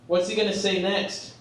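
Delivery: normal talking voice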